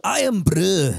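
emphatic put-on Scottish accent. Shown as a demonstration. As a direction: show off Irn-Bru in exaggerated Scottish. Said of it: "That's what this should be".

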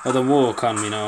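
ordinary delivery

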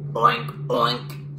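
playful storybook pig noises; pig oinks. Acted. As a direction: oink like two different pigs. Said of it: "said Pig and Pig".

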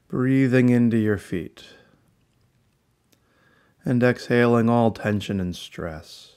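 calm, unhurried meditation guide with long pauses; soothing guided-meditation voice, gently falling phrases. Real, not acted. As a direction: ease the listener into relaxation, phrase by phrase.

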